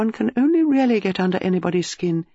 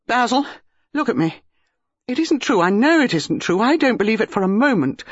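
high, emphatic insistence; urgent denial; 7 semitones higher, 3 dB louder than usual. A narrator's reading. Dinah catches her husband's shoulders, urgent denial. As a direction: insist with mounting conviction.

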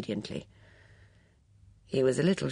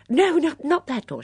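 high, louder, hasty fluttery correction; fussy elderly lady, hurried; flustered spinster; 9 dB louder than usual. Acted. Miss Bunner hastily corrects him.

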